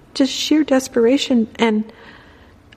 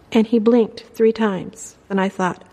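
ordinary delivery

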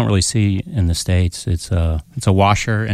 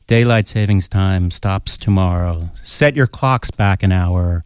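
deadpan slow reading of the note; 2 semitones lower, slower than usual. Retold or imitated. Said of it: "It said".

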